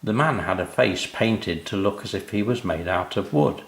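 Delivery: ordinary delivery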